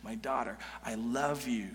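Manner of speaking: a father soothing his angry son; tender father. Retold or imitated. Father tenderly comforting his angry older son, soft and low. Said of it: "starts speaking tenderly".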